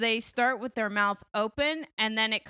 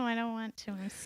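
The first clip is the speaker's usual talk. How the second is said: much softer, shrinking away; shy; 10 dB quieter than usual. Real, not acted. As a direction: go quiet out of shyness. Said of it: "I'm so shy".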